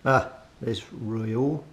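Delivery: ordinary talk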